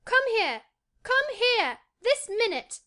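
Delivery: put-on high, loud, insistent boy; Colin, shouted orders. A narrator's reading. Boy demanding his friend come at once, shouting. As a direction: shout each short command, voice way up. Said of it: "he shouted to Dickon".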